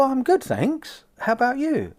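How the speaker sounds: lightly acted cashier, pitched high; friendly cashier's reply. Cashier answering a customer's hello, friendly and high. Voices a cheerful shop worker's reply.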